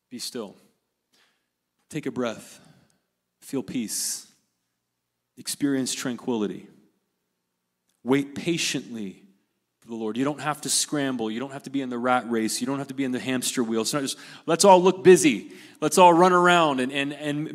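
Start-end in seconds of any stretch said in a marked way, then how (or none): 0.1-10.2 soft, low and unhurried with long pauses; calm, soothing. Real, not acted. Pastor urging the congregation to be still, calm and hushed. 14.5-16.8 loud mock rallying cry; mock frantic busyness. Pastor parodying the rat race, loud mock urgency.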